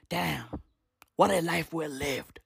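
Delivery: low, soft look back on life; acted old-timer musing quietly; wistful reminiscing. Old people looking back fondly.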